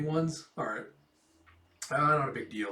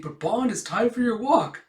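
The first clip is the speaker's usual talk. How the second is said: calling Yor voice; 8 semitones higher, 6 dB louder than usual. Acted. Yor calls the dog.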